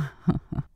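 short soft wolf chuckle; low-key breathy laugh; wolf's chuckle. A narrator's reading. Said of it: "en riant".

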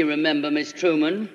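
ordinary delivery

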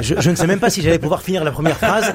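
talking through laughter; amused, chuckling as he speaks; laughing. Real, not acted. Guest jokes about being interrupted.

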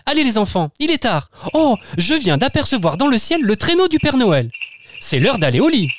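high, lively mother voice; excited mother. Acted. Mother sending the children to bed, high lively voice. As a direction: hurry the kids to bed with excitement. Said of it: "s'exclame maman".

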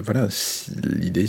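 usual speaking voice

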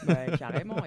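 laughing through the words; loud laughing agreement. Real, not acted. Laughs agreeing about [name].

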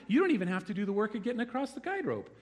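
God eagerly promising to carry you; eager reassurance. Retold or imitated. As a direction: sell the rescue eagerly, rushing at first. God voiced comforting believers.

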